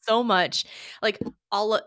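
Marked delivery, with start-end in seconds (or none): 0.0-0.6 higher, louder, slow excitement; excited. Real, not acted. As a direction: gush about the view. Recalling the balloon view.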